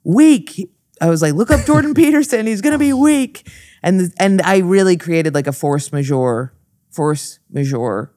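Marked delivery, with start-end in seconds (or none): 0.1-3.4 higher, louder taunting chant; mocking yell over the scuffle; taunting weak. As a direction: jeer the word weak at them. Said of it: "I'm literally going".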